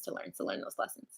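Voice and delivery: whispered aside; breathy whisper to the viewer. Real, not acted.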